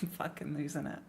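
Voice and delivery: whispered, laughing under breath. Real, not acted. Streamer losing it over words, whispered laugh. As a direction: whisper it to yourself.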